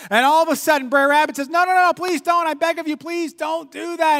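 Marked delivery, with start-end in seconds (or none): 1.5-4.2 high, loud, hammed-up pleading; mock desperate begging. Rabbit faking fear of the briar patch, high and pleading. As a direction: overplay the terror, slowing at the end.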